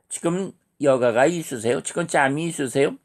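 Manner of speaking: ordinary talk, nothing marked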